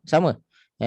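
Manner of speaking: ordinary talk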